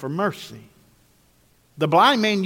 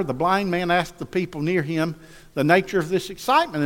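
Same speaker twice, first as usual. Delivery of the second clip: usual speaking voice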